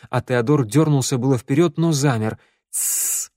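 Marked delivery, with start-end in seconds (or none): 2.7-3.3 soft, sharp hiss for silence; hissed shush. A narrator's reading. Shnyryala silences the girl she holds, hissing shush. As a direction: hush them with a long hiss. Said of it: "прошипела шныряла".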